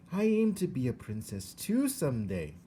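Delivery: put-on high, rough country girl voice; matter-of-fact young servant girl; Mig's voice. A narrator's reading. Mig voiced in story.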